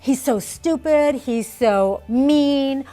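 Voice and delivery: slow, loud, scornful name-calling; judging complaint. Shown as a demonstration. Parent judging another person, slow loud contempt. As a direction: spit out the insults about him. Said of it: "And they sound something like this".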